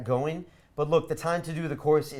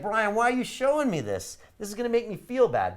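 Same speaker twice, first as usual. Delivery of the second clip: mock complaint, pitched higher; lightly voiced aggrieved listener, higher; imitating whiny students; 7 semitones higher than usual. Course members protesting to Brian, higher mock whine. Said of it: "You guys will be like".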